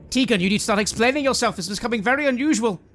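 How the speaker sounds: high, loud, fast demand; pressing Tegan to explain herself; impatient woman. Acted.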